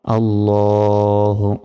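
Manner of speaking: আল্লাহু with ল held one second; slow recited আল্লাহু; lengthened la. Shown as a demonstration. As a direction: draw out the ল in আল্লাহু slowly. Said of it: "লতে এক second টান হবে".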